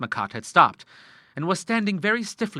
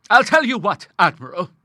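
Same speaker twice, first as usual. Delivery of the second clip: sudden exclamation; 8 semitones higher, 7 dB louder than usual. A narrator's reading. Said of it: "she exclaimed suddenly".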